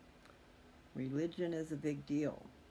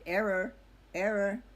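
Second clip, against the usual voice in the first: louder "Error, error" like a machine readout; mock error message; 6 semitones higher, 9 dB louder than usual. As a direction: imitate a meter flashing an error. Machine reading shows error.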